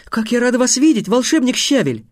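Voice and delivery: put-on high, delighted girl's voice; gushing high-voiced welcome; joyful greeting. A narrator's reading. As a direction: greet the wizard with lifted, happy pitch. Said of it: "радостно воскликнула Тиана".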